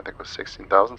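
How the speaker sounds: ordinary talk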